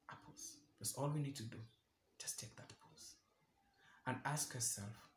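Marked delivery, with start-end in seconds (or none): 0.1-0.6 very soft, dropped right down; hushed. Real, not acted. Speaker urging listeners to take a pause, hushed. 2.2-3.2 soft, hushed whisper. Real, not acted. Speaker telling listeners to just take that pause, whispered.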